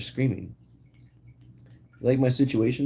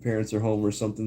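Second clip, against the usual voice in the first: ordinary talk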